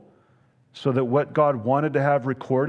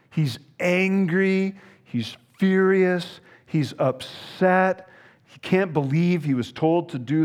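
acted rage; 4 semitones higher than usual. Preacher acting out Naaman's rage.

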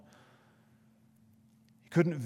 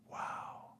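a hushed, awed wow; whispered wow; 13 dB quieter than usual. Real, not acted. Preacher whispers to himself after the verse, hushed awe. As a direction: breathe the word out, no voice, in awe.

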